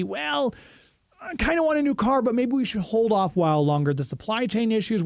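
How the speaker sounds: hesitant car buyer. Acted. Host voicing a listener who might put off buying a car, hesitant. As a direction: lightly voice someone hedging about a purchase.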